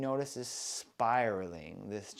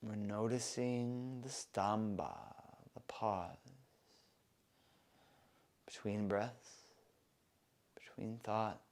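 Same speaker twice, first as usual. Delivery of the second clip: hushed; 3 semitones lower, 9 dB quieter than usual. Acted. Meditative hush on the pause.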